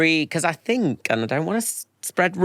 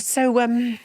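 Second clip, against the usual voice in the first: higher, oblivious asking; innocent question; 11 semitones higher than usual. Retold or imitated. Telling how he asked [name], high and oblivious. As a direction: ask it brightly, clueless. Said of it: "I said".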